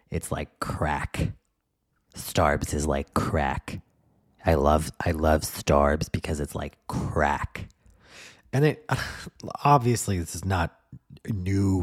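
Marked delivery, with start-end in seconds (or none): 0.1-7.7 slow dopey gush. Retold or imitated. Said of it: "She was like".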